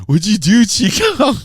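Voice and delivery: high, loud imitated accusation; mock alarm. Promotion assistants blame host for crying fan, mock alarm. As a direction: cry out in comic panic.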